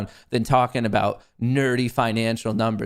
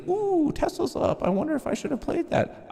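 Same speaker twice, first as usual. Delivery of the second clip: mocking viewer. Streamer mocking viewers who missed Tesla, squeaky. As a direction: mimic a regretful viewer, squeaky. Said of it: "Anyone who's like".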